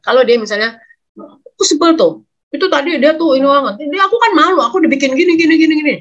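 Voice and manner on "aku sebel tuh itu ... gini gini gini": put-on high, whiny teenager complaining; whiny teen child. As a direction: play the sulky bullied kid, pitched up. Anak remaja curhat, suara anak. (remaja merengek dengan suara tinggi)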